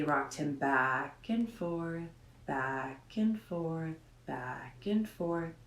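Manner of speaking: slow, soft, rocking rhythm; slow soothing rocking. A narrator's reading. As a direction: read it as if rocking a baby. Reading rocking lullaby slowly.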